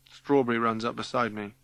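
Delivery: ordinary delivery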